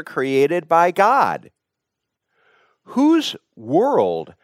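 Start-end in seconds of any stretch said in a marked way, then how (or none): none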